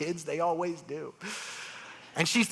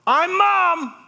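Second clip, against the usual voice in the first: loud, much higher proud call; 7 dB louder than usual. Retold or imitated. Mother calls out from the corner to the surgeon, loud proud call. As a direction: call across the dark room.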